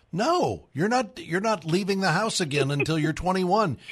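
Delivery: high-pitched mock parental alarm; panicked parent. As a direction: play a parent freaking out inside, for laughs. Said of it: "you're just thinking".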